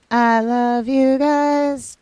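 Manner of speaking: high, slow, drawn-out love to fans; stretched-out, high-pitched fondness; singsong affection. Real, not acted. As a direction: croon it adoringly to the viewers. Affection to viewers after thanks.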